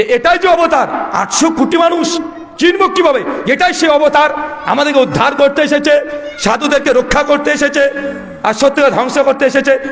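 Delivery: a preacher rousing the crowd, full force; fiery preaching. Acted. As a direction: build it up to a rousing sermon peak. Fiery sermon climax.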